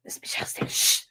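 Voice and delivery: put-on whispered kid hushing friends; hushed warning. Hallway kids warning each other she is coming, whispering.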